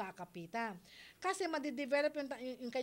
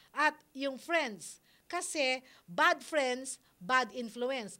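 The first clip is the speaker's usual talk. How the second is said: slow, weighty, each word leaned on; slow emphatic warning; 5 dB louder, much slower than usual. Real, not acted. Host warning parents about bad friends, slow and emphatic. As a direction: drive home the warning, slow and loud.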